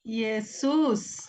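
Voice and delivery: modelled reading of Jesús; reading the breathing mark. Shown as a demonstration. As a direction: say Jesús slowly, clear j at the start. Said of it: "¿Esto cómo se pronuncia?".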